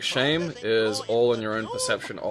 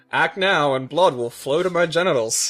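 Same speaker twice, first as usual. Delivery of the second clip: loud, higher, slow infomercial call to action; shopping channel pitch; 5 semitones higher, 6 dB louder, slower than usual. Acted. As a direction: urge the viewers like a hammy TV salesman. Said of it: "the fucking shopping channel".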